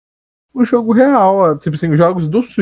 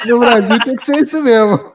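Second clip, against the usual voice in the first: talking through laughter; words broken by laughs; laughing; 8 semitones higher than usual. Real, not acted. Still laughing after the joke.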